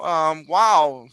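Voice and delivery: ordinary talk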